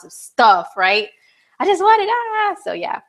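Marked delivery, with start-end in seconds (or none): 1.6-2.6 high-pitched pleading whine; hammed-up craving wail; mock whine. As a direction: whine like you simply must have it. Acting out impulsive Libra wanting things.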